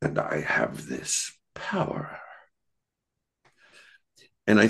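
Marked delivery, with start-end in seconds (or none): 0.0-2.2 put-on hushed, low phony German accent; near-whispered mock German vamp, soft and low. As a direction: imitate a comic German accent, almost whispering. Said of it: "she has this phony lisp German accent".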